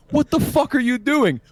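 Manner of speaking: high, loud incredulous yell; shocked yell. Retold or imitated. Bursting in on the coworker with a sledgehammer, shocked yell. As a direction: blurt it out in disbelief.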